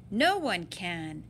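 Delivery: plain reading voice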